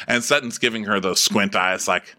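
ordinary delivery

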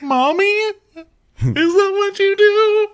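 tiny squeaky kid imitation; mock child voice. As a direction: play an innocent child questioning mommy. Voicing the kids to their mother.

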